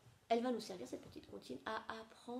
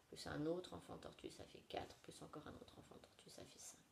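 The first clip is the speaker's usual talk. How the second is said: compte en chuchotant; chuchoté; 3 semitones lower, 8 dB quieter than usual. Shown as a demonstration. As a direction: souffle les chiffres tout bas. Comptage chuchoté pour les enfants. (compté en chuchotant)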